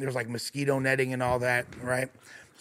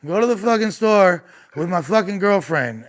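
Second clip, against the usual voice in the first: put-on louder and higher, pushy drunk demand; drunk boyfriend insisting; 5 semitones higher, 5 dB louder than usual. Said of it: "He's like".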